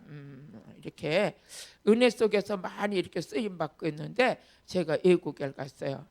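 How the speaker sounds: ordinary talk, nothing marked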